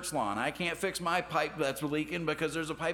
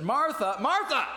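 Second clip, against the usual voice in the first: higher, louder name called twice to get attention; calling out; 12 semitones higher, 6 dB louder than usual. Retold or imitated. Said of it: "but Jesus just said".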